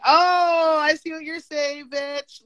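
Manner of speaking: dawning realization. Retold or imitated. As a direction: act out the penny dropping, pitched high. Retelling own moment of sudden realization.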